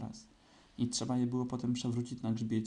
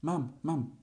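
eager cry of having found it; higher-pitched, mildly excited exclamation; excited call; 4 semitones higher than usual. A narrator's reading. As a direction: call out keenly, lifting the pitch. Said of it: "zawołał Krzyś z przejęciem".

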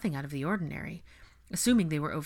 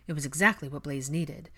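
ordinary delivery, nothing marked

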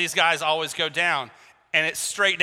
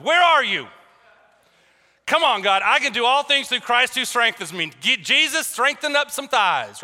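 raised, pleading shout to heaven; crying out; 6 semitones higher, 4 dB louder than usual. Retold or imitated. Exhausted hiker to God on the way downhill, crying out. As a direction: cry out to God in comic exasperation. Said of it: "I was crying out to God".

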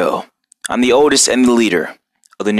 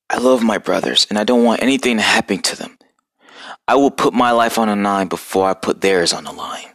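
worried confession; 4 semitones lower than usual. Acted. Worried turtle confides in master.

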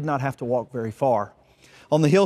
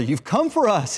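mild narrated shout; 7 semitones higher than usual. A narrator's reading. Younger brother greets his long-awaited eldest brother, mild shout. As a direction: call out with a raised pitch, short of yelling. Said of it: "he shouted".

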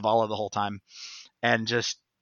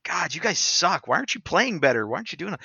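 whiny; 8 semitones higher, 4 dB louder than usual. Retold or imitated. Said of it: "I was like".

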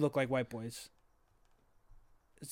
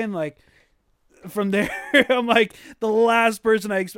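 usual speaking voice

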